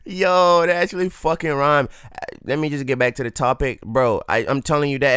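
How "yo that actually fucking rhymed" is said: laughing. Real, not acted. Laughing that diss rhymed.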